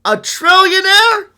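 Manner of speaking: high, loud, drawn-out disbelief; incredulous. Real, not acted. Weighing trillionaires against billionaires, incredulous cry. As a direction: cry out the word, stretching it slowly.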